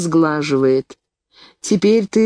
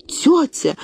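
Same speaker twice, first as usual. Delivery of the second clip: a sudden high cry of "Aunt!"; exclaiming; 9 semitones higher than usual. A narrator's reading. Niece grabbing her aunt's hands, crying out. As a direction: call out to her in alarm. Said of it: "воскликнула я".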